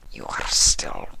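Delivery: hushed low creature voice; whispering changeling. Acted.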